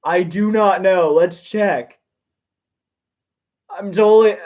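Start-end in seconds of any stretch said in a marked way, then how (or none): none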